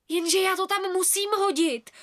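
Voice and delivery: high, pleading insistence; desperate girl defending her wish. A narrator's reading. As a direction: beg desperately. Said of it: "zoufale jsem bránila".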